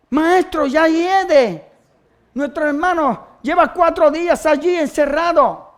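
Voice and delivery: high, drawn-out acted objection; Marta's protest. Acts Martha's protest at tomb. (protesta actuada, en voz alta)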